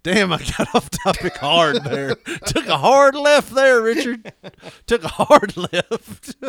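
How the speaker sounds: mock self-reproach. Host listening back to his own tangents, mock reproach. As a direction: call yourself out, breaking into laughs.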